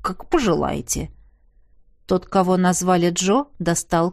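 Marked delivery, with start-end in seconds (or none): 0.0-1.1 grudging low jailer. Acted.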